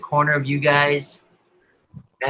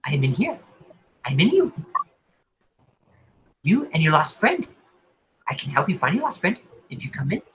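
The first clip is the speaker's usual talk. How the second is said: crazed mind voice; faster than usual. Acted.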